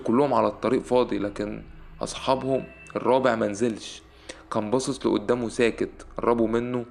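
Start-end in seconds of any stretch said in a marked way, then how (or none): none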